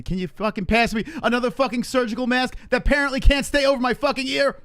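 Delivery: high, loud blow-up; exasperated shouting. Acted. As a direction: lose it and yell at your helper over a broken mask. Ranting about broken mask strap.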